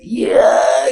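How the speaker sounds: high tearful joyful shout; overjoyed yes. Acted. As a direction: cry out yes with happy tears. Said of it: "Saking senengnya orang ini lantas berjoget".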